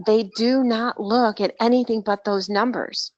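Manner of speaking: ordinary talk, nothing marked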